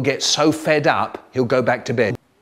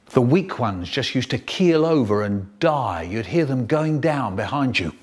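ordinary delivery, nothing marked

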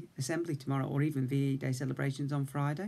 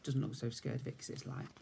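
plain reading voice

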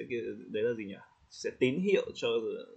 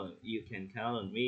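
slow, softer "You can count on me", with no interest; indifferent, detached; 7 dB quieter, slower than usual. Shown as a demonstration. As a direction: say it as if you couldn't care less. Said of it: "nghe nó kiểu lãnh đạm ấy". (giọng lãnh đạm, chậm và nhỏ)

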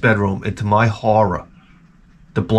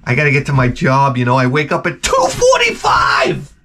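mock early riser; 4 semitones higher than usual. Mock guest explains leaving early for work, whiny. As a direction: whine the excuse, rising to a shout. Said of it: "You're like".

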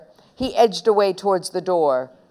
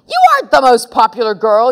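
fast, loud, higher retort; sour yell; 5 semitones higher, 8 dB louder, faster than usual. A narrator's reading. Girl contradicting her bossy friend, sour yell. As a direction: snap back loudly and quickly. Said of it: "yelled Susan sourly".

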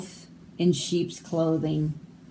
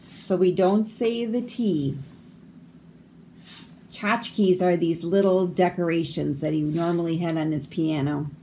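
normal talking voice

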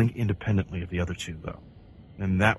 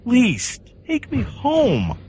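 high, loud, slow desperate plea; pleading with the stranger to be taken home; begging; 6 dB louder, much slower than usual. Acted. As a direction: wail it out, begging. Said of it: "I begged".

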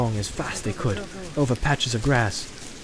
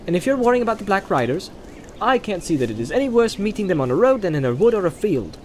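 put-on higher, lively young hobbit arguing back; Pippin, objecting; 7 semitones higher than usual. A narrator's reading. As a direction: push back on the plan, a bit cocky. Pippin's dialogue in read story.

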